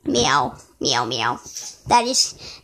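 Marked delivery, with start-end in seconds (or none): 0.0-1.4 cat voice. Pretend. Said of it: "that is cat for".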